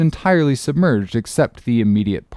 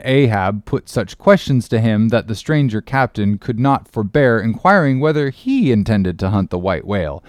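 ordinary delivery

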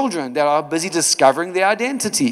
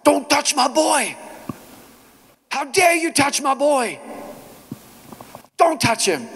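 high, fierce protective cry, much higher; angry outburst; 11 semitones higher than usual. Retold or imitated. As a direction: snap at the bully to protect the child. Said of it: "I was so angry".

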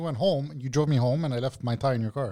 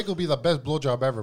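normal talking voice

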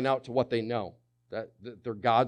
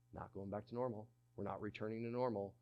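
resigned murmur; 3 semitones lower, 14 dB quieter, faster than usual. Retold or imitated. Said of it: "it's like".

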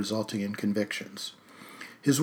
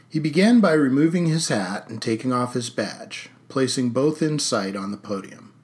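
usual speaking voice

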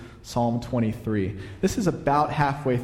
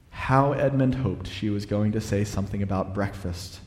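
usual speaking voice

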